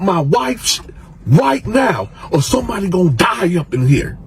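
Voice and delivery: loud, high enraged threats; furious shout. Retold or imitated. Angry inmate threatening the whole pod, shouting.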